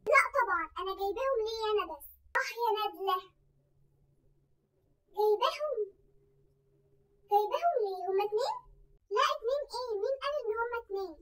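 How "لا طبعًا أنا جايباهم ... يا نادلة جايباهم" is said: ordinary talk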